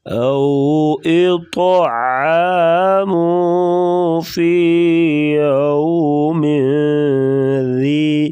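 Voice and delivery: slow, melodic tajweed chant; Quran recitation. Shown as a demonstration. Teacher reciting for students, slow melodic tajweed. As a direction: draw each word out slowly in recitation. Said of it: "ذال کو نرمی سے پڑھیں گے".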